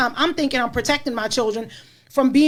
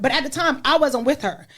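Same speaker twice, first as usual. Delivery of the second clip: usual speaking voice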